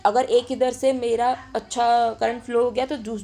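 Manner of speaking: ordinary talk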